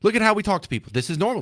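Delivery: ordinary talk, nothing marked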